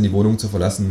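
usual speaking voice

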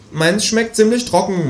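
high, slow grumble; grumbling kangaroo. Acted. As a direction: complain like a spoiled kid. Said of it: "mault das Känguru". (maulendes Känguru, hoch und langsam)

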